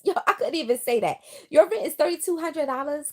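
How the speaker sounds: usual speaking voice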